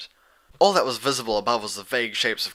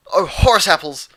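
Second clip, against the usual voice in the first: alarmed exclamation; 8 semitones higher, 10 dB louder than usual. Acted. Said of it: "Dash breathed".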